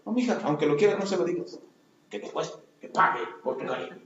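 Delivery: low, soft, whispered advice; hushed bitter mother. Retold or imitated. Wounded mother warning her daughter, soft and whispered. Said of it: "le va a enseñar a sus hijas".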